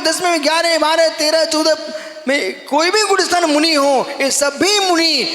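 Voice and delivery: high, insistent sermon voice; impassioned preaching. Real, not acted. Monk telling devotees all sweets come from cane, impassioned preaching. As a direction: drive the list home, item after item.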